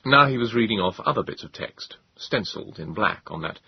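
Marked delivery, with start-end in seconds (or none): none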